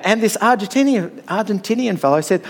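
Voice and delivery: ordinary talk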